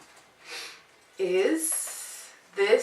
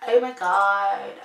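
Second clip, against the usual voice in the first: mock Canadian accent; 9 dB louder, slower than usual. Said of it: "such a Canadian accent".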